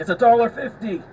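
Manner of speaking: mock the guy yelling at the cashier; angry yelling. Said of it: "yelling at the guy in the front".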